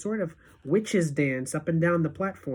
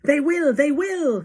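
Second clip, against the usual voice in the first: higher, louder chant of agreement; eager cry; 9 semitones higher, 5 dB louder than usual. Acted. Witches in the audience answer, eager cries. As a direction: cheer it back to your leader. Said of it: "cried the audience".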